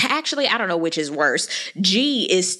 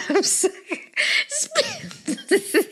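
laughing; 12 semitones higher, much slower than usual. Real, not acted. Laughing breaks her speech.

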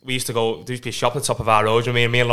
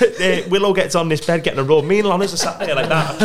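ordinary talk, nothing marked

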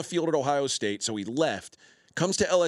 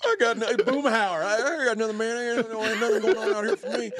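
mock Aaron Rodgers with a Hank Hill twang, higher and laughing; Southern twang impression; 8 semitones higher than usual. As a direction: play up a lazy Texas twang while cracking up. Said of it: "He sounds like fucking Hank Hill".

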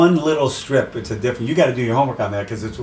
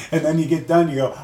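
usual speaking voice

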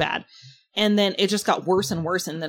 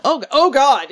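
high, loud mock-horrified groaning exclamation; mock dismay; 8 semitones higher, 10 dB louder, slower than usual. Woman recalling the bad smell in her car, mock dismay. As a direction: play up sudden alarm at yourself. Acting out own dawning horror.

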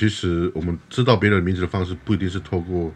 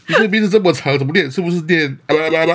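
much higher, louder sneering voice; mock the long name like a rude outsider; mocking rude person; 10 semitones higher, 4 dB louder than usual. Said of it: "你有遇过这种人吗". (更夸张地嘲弄，高声模仿无礼的人)

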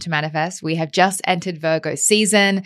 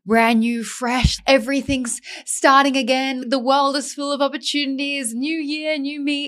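high-pitched imitation of fresh-start hype; mock New Year excitement; 8 semitones higher than usual. Host mimicking how we all feel at New Year, mock excitement.

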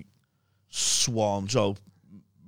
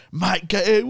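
angry summons; 9 semitones higher, 7 dB louder than usual. Retold or imitated. Steve-O voiced in story.

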